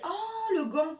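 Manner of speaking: very high, loud squeal of delight; delighted gasp. Real, not acted. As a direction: squeal at a lovely surprise. Delighted discovery of the glove. (cri de surprise ravi)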